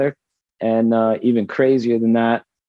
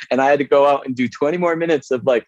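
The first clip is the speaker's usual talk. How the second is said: normal talking voice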